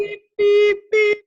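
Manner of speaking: very high, loud beep beep; imitated beeping. Sounding an alarm at the cat's butt, very high and loud.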